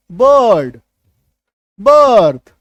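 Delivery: बर्ड and बर्थ said loud with the hidden अ sound; loud demo words. Shown as a demonstration. Teacher showing class the hidden अ sound, loud. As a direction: call out बर्ड, बर्थ loudly, stressing the अ vowel. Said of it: "यहाँ पे अ छुपा हुआ है".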